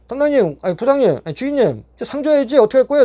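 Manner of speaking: 높은 목소리로 몰아붙이는 항의; 억울한 듯 계속 들이밀기; 상 달라 떼쓰기. Retold or imitated. 간부에게 상 달라고 졸라댐. (교도관에게 상 달라고 높이 떼쓰는 말투)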